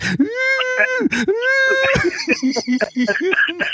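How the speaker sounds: high squealing shriek of laughter; squealing pig-like laugh. Retold or imitated. Veteran mimics squealing Gallegos for his buddy, high squeal.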